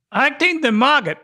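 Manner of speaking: amateur Irish lilt; attempted Irish accent. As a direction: read the slang in a rough Irish accent. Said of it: "You gotta use an Irish accent".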